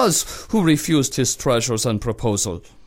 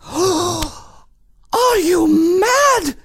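shocked high gasp; 4 dB louder, much slower than usual. Acted. Shocked lady in waiting.

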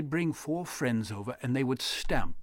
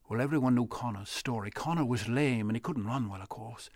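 ordinary delivery

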